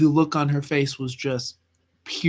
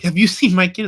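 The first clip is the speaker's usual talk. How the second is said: louder, higher frantic question; imitated panicked mom; 4 semitones higher, 9 dB louder than usual. Mom asks strangers about her lost child, mock frantic. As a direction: mimic a mother searching in alarm. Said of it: "She's like".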